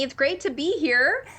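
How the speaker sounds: usual speaking voice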